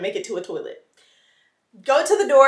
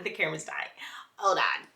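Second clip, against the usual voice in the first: whispered aside; 4 semitones lower, 8 dB quieter than usual. Real, not acted. Quick aside, camera dying.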